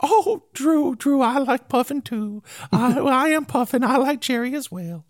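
playful high dog voice. Acted. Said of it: "That was my [name] voice".

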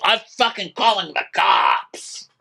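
high mock-hysterical yell; high-pitched retold outburst. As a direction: cry the threat out high, imitating a furious guy. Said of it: "Craig is downstairs yelling".

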